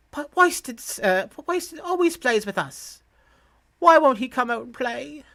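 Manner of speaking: put-on high-pitched little kid, pleading; child's voice. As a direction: voice a small child missing a playmate.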